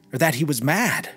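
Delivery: plain reading voice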